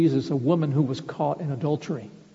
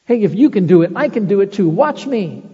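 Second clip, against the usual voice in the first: louder, cocky bragging as Peter; brash boast; 3 semitones higher, 7 dB louder than usual. Retold or imitated. Preacher imitating brash Peter, louder cocky boast. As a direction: show off like you can do anything. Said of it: "Oh, how brash".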